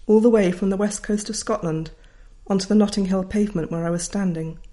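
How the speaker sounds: usual speaking voice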